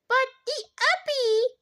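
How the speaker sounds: put-on high, babyish toddler whine; a little boy reaching for the puppy. A narrator's reading. Toddler begging to see the puppy, high baby voice. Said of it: "The bean reached out his arms".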